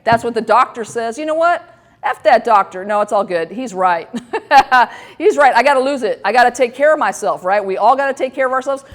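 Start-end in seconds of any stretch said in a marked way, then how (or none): none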